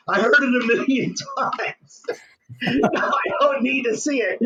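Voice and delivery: amused mock refusal, breaking into laughs; laughing retelling. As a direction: retell the wife's brush-off through laughter. Laughing while retelling wife's refusal.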